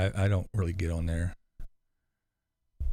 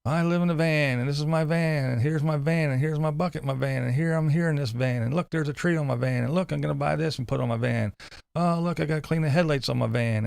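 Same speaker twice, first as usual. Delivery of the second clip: higher, run-on mock vlogger patter; mock one-topic vlogger; 7 semitones higher than usual. Imagined van vlogger talking about one thing, mocking. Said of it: "talking about one thing, you know".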